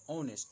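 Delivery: ऑनेस्ट said starting on the vowel "o", no h sound; silent h pronunciation. Shown as a demonstration. Teacher shows students how to say ऑनेस्ट with silent h. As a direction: say ऑनेस्ट as "onest", dropping the h. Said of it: "ऑनेस्ट में औ से ... pronunciation करना है".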